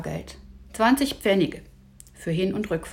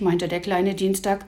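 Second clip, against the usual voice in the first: usual speaking voice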